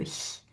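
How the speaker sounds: "hi" as a voiceless soft palatal hiss; isolated ich-sound. Shown as a demonstration. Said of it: "ce son-là".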